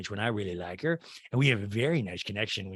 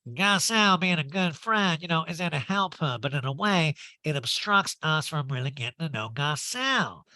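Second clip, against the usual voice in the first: Erika; 5 semitones higher than usual. Retold or imitated. Recapper mimicking a castmate criticizing a friend, drawling caricature. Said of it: "And Eric is like".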